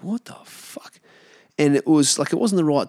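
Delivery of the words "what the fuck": breathy whispered what the fuck, no voice at all; a stunned inner whisper; whispered disbelief. Retold or imitated. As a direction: mouth it under your breath in shock. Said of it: "And I'm like".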